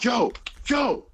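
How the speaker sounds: high, urgent "yo" to get attention; mildly acted brother sounding an alarm; urgent alert. Brother warning his friend in the car, urgent alert.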